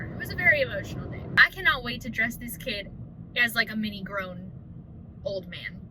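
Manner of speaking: ordinary talk, nothing marked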